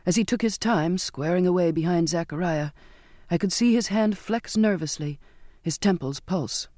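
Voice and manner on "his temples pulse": plain reading voice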